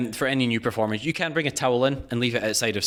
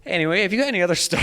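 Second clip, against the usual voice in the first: fast, raised and higher, barging in; 6 semitones higher, much faster than usual. Pretend. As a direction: blurt it to cut her off. Cutting off the argument.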